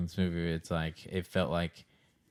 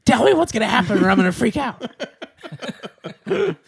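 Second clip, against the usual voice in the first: panicky pleading; 9 dB louder than usual. Retold or imitated. Acting panicked moviegoer.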